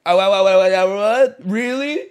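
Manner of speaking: put-on dad cheering on a stranger's babbling child; over-the-top excitement. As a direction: hype the kid up like it's the best thing ever. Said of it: "Now I'm like".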